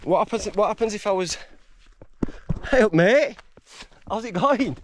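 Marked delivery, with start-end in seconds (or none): 2.7-4.8 higher, louder hail to a passer-by; calling out a greeting. Real, not acted. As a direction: call out a friendly hello. Greets a passing walker.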